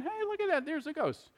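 airy high voice, totally unbothered; a breezy, high, offhand remark about a ghost; casual mock-unbothered. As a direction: say it like spotting something mildly interesting. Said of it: "No, they screamed in terror".

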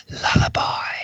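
breathy whispered lullaby; hushed whisper. Shown as a demonstration. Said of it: "please repeat after me".

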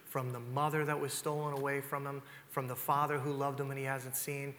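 ordinary talk

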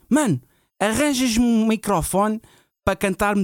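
high, slow earnest request; dead serious. Retold or imitated. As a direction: ask in total earnest. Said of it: "ele estava a falar muito a sério".

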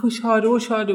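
high-pitched alarmed question; a child frightened by a ringing phone; startled cry. Retold or imitated. Mika startled by the ringing phone, crying out high. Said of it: "هني ميكا صار يعني يصرخ".

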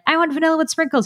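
put-on high little-girl voice; five-year-old asking dad for vanilla, high child voice. Child self voiced in story.

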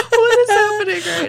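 laughing. Real, not acted. Laughing at the squeaky voices.

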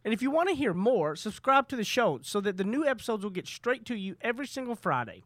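upbeat promo read. Acted. Podcast intro read.

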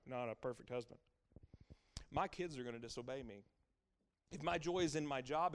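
ordinary delivery

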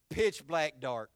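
ordinary talk

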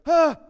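sharp high startled shriek; startled scream. Retold or imitated. Startled disciples letting out a sudden high shriek. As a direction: yelp in fright. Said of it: "makes people go".

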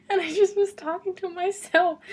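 high, loud, laughing as she talks; words bubbling through laughter. Real, not acted. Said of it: "I'm laughing".

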